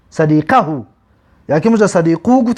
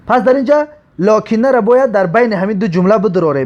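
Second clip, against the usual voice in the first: ordinary talk, nothing marked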